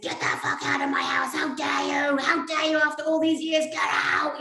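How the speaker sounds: shouting at the ghost in a rage; furious yell at an intruder ghost; angry shout. Retold or imitated. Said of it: "I went mad and said".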